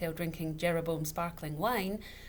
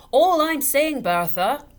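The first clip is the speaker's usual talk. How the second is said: loud, high tipsy bluster; half-shouting drunk father; almost shouting; 9 semitones higher, 16 dB louder, slower than usual. A narrator's reading. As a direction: raise your voice over the laughter. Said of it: "Mister Dovetail was almost shouting".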